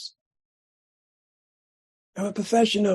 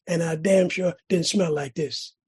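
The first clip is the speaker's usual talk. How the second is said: ordinary talk, nothing marked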